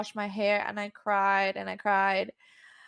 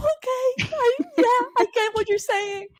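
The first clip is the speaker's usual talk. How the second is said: high, loud, flustered "okay, I get it"; brushing off praise in a giddy high voice; nervously high and eager; flustered high reply; 5 dB louder than usual. Retold or imitated. Retelling nervous reply to mentor.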